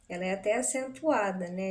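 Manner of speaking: usual speaking voice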